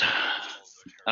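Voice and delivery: normal talking voice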